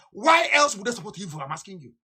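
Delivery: usual speaking voice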